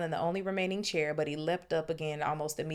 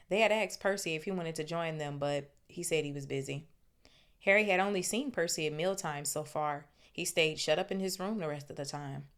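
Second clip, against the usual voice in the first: plain reading voice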